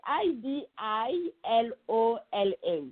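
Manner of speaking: slow letter-by-letter spelling. Shown as a demonstration. Guest helping the host with the brand name, spelling out slowly.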